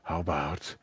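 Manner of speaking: ordinary delivery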